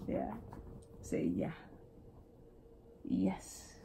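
whispered coaxing; soft voiceless prompt. Real, not acted. Coaxing baby to talk.

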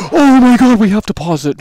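high, loud alarmed outburst; reacting in alarm to the screen; excited gasp. Real, not acted. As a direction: gasp and blurt it out. Panicked at game.

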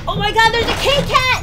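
high, loud thrilled cry; excited shout. Real, not acted. Finding a cat item in the shop, excited shout. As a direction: shout it with delight at the find.

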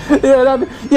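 laughing through "Yeah, that'd be". Real, not acted. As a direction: break up with laughter mid-phrase. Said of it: "laughing at the joke".

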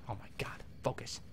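whispered under the breath, exasperated. Real, not acted. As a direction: hiss it to yourself in disbelief.

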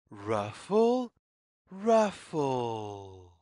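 usual speaking voice

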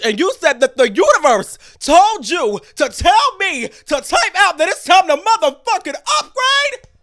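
mock-offended rant; indignant shout. Real, not acted. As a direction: blast the commenter.

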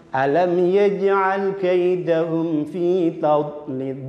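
slow, drawn-out verse reading; slow recitation. Shown as a demonstration. Teacher modelling the full verse. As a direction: recite it slowly and clearly. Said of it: "teacher models verse".

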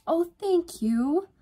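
high, loud, drawn-out thanks; effusively grateful. A narrator's reading. Yvonne thanking Alex for watching over Freya, effusive.

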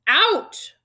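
high, loud explosive command; roaring. A narrator's reading. Uncle throws both boys out, roaring. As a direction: roar them out of the room. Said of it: "roared Uncle Vernon".

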